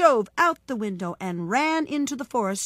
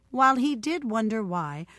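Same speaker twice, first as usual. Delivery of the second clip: plain reading voice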